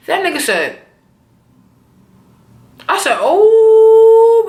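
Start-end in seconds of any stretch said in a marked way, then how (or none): 3.2-4.5 long loud high gasped oh; shocked oh. Real, not acted. Viewer stunned by Tommy's cold reply, loud long oh. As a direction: belt a stunned oh.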